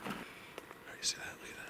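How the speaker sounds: whispering so as not to disturb anything; breathy hush, barely voiced. Real, not acted. Vlogger creeping toward the structures in a whisper.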